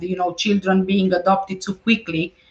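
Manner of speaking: ordinary talk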